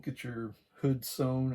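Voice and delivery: ordinary talk, nothing marked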